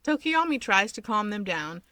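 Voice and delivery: ordinary delivery, nothing marked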